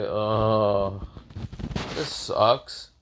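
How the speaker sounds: drawn-out, sighing complaint; exasperated sigh. A narrator's reading. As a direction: let it out on a weary sigh. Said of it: "the person sighs and say".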